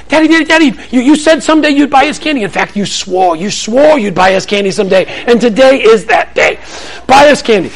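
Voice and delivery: put-on a kid wheedling until dad caves; mock whiny kid. Kids nagging for candy.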